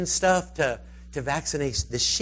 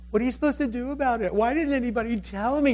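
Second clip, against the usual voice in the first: high, rapid exasperated complaint; mock flustered outburst; 8 semitones higher, faster than usual. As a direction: act out her indignant questions in a raised pitch. Retold exasperated woman quote.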